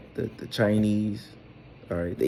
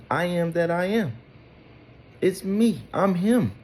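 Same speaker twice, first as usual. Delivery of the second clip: much higher, emphatic voice of God; voicing Yahweh; 9 semitones higher than usual. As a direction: announce it as God himself. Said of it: "That's what Yahweh's saying".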